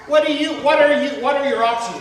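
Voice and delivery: loud, high, rousing preacher's call; fervent preaching. Acted. Preacher pressing the crowd for options, loud and high. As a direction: press the congregation for an answer.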